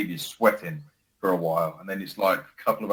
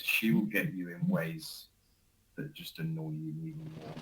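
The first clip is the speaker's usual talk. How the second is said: whisper; 4 semitones lower, 10 dB quieter than usual. Real, not acted. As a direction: lean in and whisper it like a secret. Describing his wife's silent treatment.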